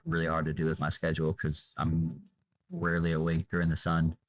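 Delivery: normal talking voice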